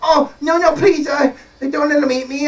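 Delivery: high-pitched frightened begging; mock pleading. Jack begging the giant's wife, hammed-up fear. Jack begs not to be eaten.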